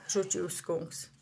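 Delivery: usual speaking voice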